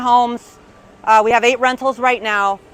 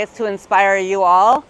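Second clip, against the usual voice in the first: ordinary talk, nothing marked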